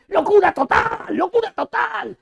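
high, slow, drawn-out commentator cry; mock announcer. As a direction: stretch out locura like a hyped announcer. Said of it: "un tipo que me dice". (relator exagerado, agudo y lento)

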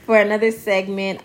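ordinary delivery

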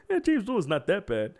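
high, whiny mock of a defender; mocking dismissive voice. Streamer mocks a friend who defends Dolan, whiny and dismissive. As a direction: sneer it like a lazy excuse. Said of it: "that's what I hear".

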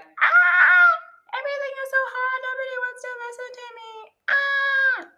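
high, fast, hammed-up despairing cry; exaggerated whiny wail. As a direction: wail like everything is hopeless, overplayed. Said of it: "and I'm like".

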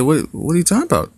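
high, fast, incredulous "what"; shocked disbelief. Retold or imitated. Dad hearing his daughter is skipping the meet, stunned and fast. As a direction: jump up in pitch, rushing the question.

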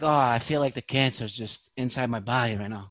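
soft, low, half-joking dread; quietly imitating his own health panic; mock worried hypochondriac. Comedian mocking his own cancer scare, low and soft.

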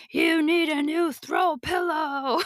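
high, drawn-out hypnotic command; brainwashing cartoon bullseye. Cartoon bullseye luring a shopper in with her cart, hypnotic. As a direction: chant it slowly, high, like a hypnotizing mascot.

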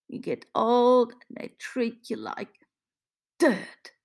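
put-on high, bitter accented outburst; embittered old Italian woman; almost spitting. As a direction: spit out the last word in disgust. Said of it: "She almost spits the last phrase".

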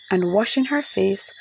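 usual speaking voice

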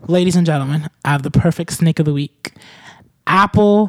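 loud, grand segment announcement; mock announcer. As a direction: announce it like a showman, building to a loud reveal.

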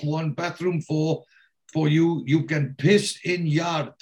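put-on herd them off the bus in a thick Eastern European accent; foreign foreman accent. [name] voiced herding immigrants.

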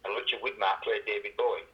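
ordinary delivery

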